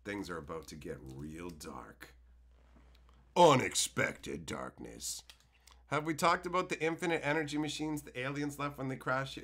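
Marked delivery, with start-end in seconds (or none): 0.0-5.2 low, slow ominous voice; mock movie-trailer voice, low and slow; ominous announcer. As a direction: intone it like a dark trailer. Announcing dark turn.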